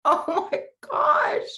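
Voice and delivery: high, slow, stunned oh my gosh; shocked gasp. Retold or imitated. Hearing the neighbor's armadillo story, high stunned gasp. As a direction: gasp it out in disbelief. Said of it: "And I'm like".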